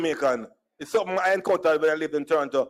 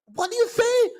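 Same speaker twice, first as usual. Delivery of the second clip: very high, incredulous squawk; baffled squawk. Retold or imitated. Store lady not understanding a customer, baffled squawk.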